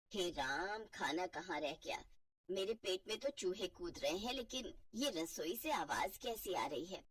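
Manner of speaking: put-on a hungry, fed-up elderly mother; old mother-in-law. (भूखी, झुंझलाई बूढ़ी सास)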